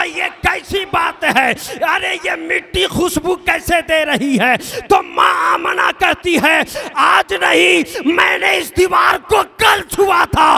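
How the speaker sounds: preacher at full force, astonished; fervent preaching shout. Acted. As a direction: cry it out to stir the crowd.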